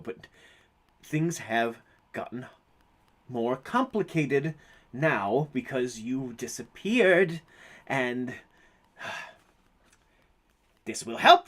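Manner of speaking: slow, measured, understated authority; lightly voiced stern character. Game master's character tells someone they are going nowhere, lightly stern. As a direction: lay down the law slowly, with weighty pauses.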